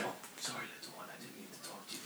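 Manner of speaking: fast giggly whisper. Acted. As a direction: whisper it quickly, amused. Apologizes whispering to little one.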